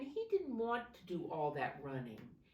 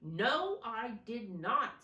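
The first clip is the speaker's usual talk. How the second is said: loud, high indignant denial; indignant snort; 6 semitones higher, 6 dB louder than usual. A narrator's reading. Tortoise denies wanting to swim, indignant and loud. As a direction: snap back, offended. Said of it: "snorted Tortoise".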